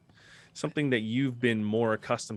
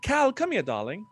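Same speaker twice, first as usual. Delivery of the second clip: high, louder summons dropping on the last word; excited elf bard; 7 dB louder than usual. Acted. Elf bard beckoning Cal to look, excited. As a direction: call a companion over, delighted.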